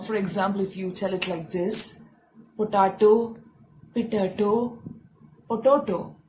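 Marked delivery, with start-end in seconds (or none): 2.6-3.4 POTATO said po-TAH-to, louder; mispronunciation. Shown as a demonstration. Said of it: "when you pronounce it wrong". 3.9-4.8 POTATO said pi-TUH-to; mispronunciation. Shown as a demonstration. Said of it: "when you pronounce it wrong". 5.5-6.2 POTATO said po-TOH-to, all round o's; mispronunciation. Shown as a demonstration. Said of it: "all these are wrong ways".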